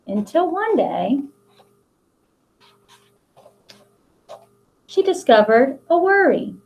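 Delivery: put-on reading a picture book aloud to children; expressive picture-book read-aloud; storybook narration. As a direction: tell the story to the class with long pauses.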